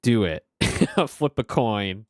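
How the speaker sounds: ordinary talk, nothing marked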